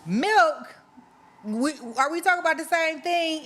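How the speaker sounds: can't believe what she heard; shocked disbelief. Real, not acted. Guest says milk in tacos.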